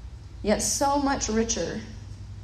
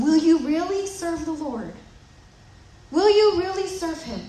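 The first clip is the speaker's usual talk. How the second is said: probing Joshua; 6 semitones higher than usual. Retold or imitated. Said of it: "Joshua's asking them".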